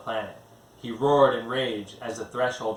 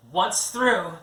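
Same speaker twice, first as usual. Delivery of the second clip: high, commanding alien voice issuing orders; crisp, urgent commands from a high-voiced handler; Giseldra giving orders; 8 semitones higher, 4 dB louder than usual. A narrator's reading. Giseldra orders Ganon through the firefight, high and commanding. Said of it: "Giseldra continued".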